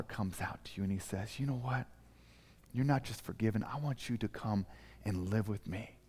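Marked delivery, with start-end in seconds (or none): 1.4-6.0 tender father. Acted. Father welcomes the forgiven child.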